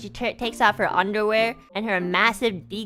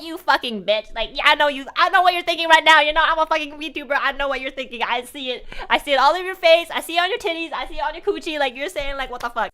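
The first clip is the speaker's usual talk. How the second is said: higher pitched mock rant; 5 semitones higher, faster than usual. Ranting at the stripper about her judging face. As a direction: rant at her, half joking. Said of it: "And I was like".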